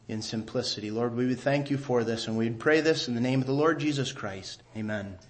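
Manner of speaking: soft, low closing prayer; hushed prayer voice. Real, not acted. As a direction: pray it quietly to close.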